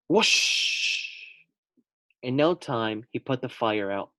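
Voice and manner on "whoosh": breathy rushing whoosh; water whoosh. Acted. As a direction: gush like water from a hose. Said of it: "Water shot from the nozzle".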